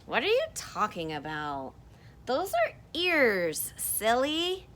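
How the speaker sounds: high, loud teasing character voice. Acted. Teacher voicing the rabbit-seer teasing the other, playful. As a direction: tease the other one as silly. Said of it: "And this person says".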